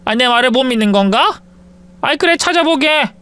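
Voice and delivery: put-on an old mother put out at being doubted; offended mother-in-law.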